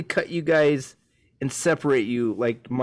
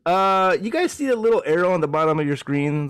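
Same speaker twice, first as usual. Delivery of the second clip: usual speaking voice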